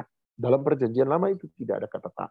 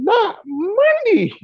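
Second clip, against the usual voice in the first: very high, loud, drawn-out yell; parent yelling; 6 dB louder, much slower than usual. Retold or imitated. Fed-up father screaming at his son to bathe. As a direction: scream it slowly at the top of your voice. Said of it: "Saya teriak dong".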